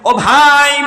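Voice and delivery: high chanted cry. Acted. People of hell cry out to a brother, high call. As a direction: call it out high, on a held note. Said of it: "চিৎকার দিয়ে বলবে".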